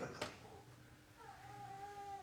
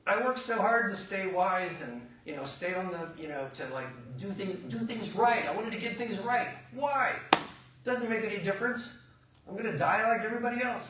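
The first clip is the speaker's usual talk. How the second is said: high, loud, building complaint; acted indignant frustration, peaking on why; exasperated protest; 6 semitones higher, 6 dB louder than usual. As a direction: protest how pointless all the effort was. Acting out Solomon's frustrated complaint.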